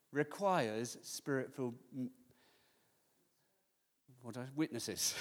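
usual speaking voice

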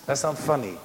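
ordinary talk

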